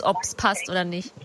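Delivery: ordinary talk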